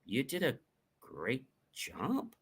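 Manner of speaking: very soft, halting compliment with rising end; doubtful praise. Shown as a demonstration. Coach demonstrating tone on a compliment, doubtful. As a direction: pause before the praise and question it quietly. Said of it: "If I say to you".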